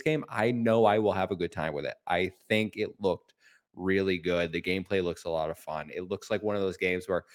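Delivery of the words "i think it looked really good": ordinary talk, nothing marked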